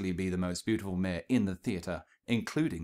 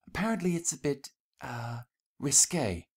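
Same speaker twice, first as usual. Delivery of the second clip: coy whisper; 6 semitones higher than usual. Acted. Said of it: "Rarity whispered".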